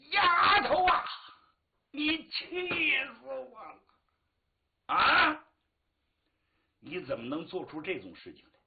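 loud, high outburst, words stretched out; enraged father. Acted. Father berating his daughter as he comes to, enraged. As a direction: berate with swelling, wounded fury. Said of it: "气他。".

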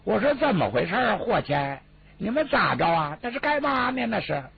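high, thin, grating voice of the younger man; thin little voice. Acted. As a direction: squeeze it into a reedy, unpleasant little voice. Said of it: "紧跟着有个小细声 / 这难听劲儿就甭提了".

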